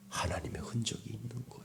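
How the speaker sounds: hushed, quick whisper. Real, not acted. Preacher answering his own question to the congregation, whispered. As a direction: drop to a very soft whisper for the answer.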